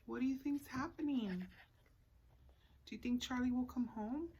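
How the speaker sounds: ordinary delivery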